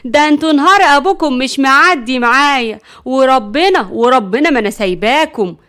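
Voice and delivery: loud irate scolding; angry, on edge. A narrator's reading. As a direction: explode at the boys with a threat. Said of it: "نرجس قالت بعصبية". (بعصبية وزعيق)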